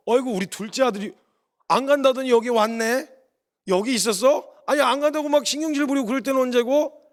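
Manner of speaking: acted-out fatherly amazement; pleasantly surprised father. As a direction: tease the son you are pleased to see. Father delighted to find son there.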